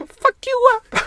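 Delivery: a wrestler on helium threatening. Acted. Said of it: "wrestlers on helium".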